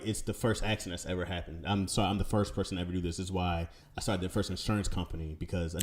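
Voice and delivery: dry sarcastic mock apology; mock-sincere sarcasm. Real, not acted. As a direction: lay on the sarcasm quietly. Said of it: "now you sarcastic".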